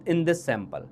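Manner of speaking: usual speaking voice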